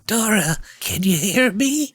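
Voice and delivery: high, slow eerie calling; creepy voice. Retold or imitated. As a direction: call her name like a ghost. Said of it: "creepy voice saying".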